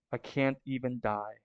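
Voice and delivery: very soft, broken despair; crying. Acted. Loki after falling from the tree, soft tearful despair. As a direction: barely voice it, crushed and tearful. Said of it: "And so he's crying".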